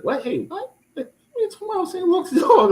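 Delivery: incredulous disbelief. Retold or imitated. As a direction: replay the shock of being mistaken for a dog walker. Retold reaction to neighbor's question.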